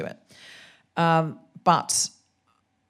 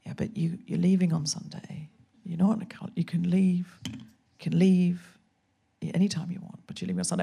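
soft, whispered, calm reassurance; conscience voice; 3 dB quieter than usual. Retold or imitated. Conscience reassuring the panicked comedian, softly whispered. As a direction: hush it like a patient inner voice. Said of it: "my conscience was like".

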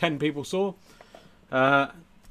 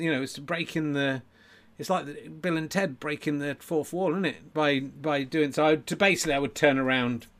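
usual speaking voice

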